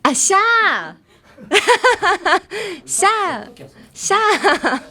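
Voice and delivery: high, loud playful hiss with laughter; snake hiss imitation. Shown as a demonstration. Showing students a snake hiss, laughing. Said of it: "スーみたいな感じ".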